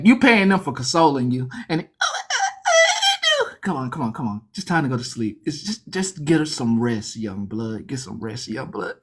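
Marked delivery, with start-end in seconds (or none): 2.0-3.5 very high hammed-up whimper; mock whimpering. Newcomer whimpering in the cell, high mock whimper. 3.6-8.9 lower, soft soothing murmur; mock consoling. Inmates calming the newcomer, soft and low.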